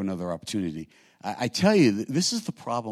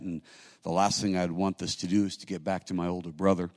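usual speaking voice

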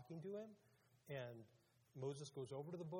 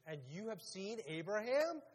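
loud, raised disbelieving question; incredulous; 5 semitones higher, 8 dB louder than usual. Acted. Crowd questions Jesus's claim, loudly incredulous. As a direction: build to a scoffing question on the name.

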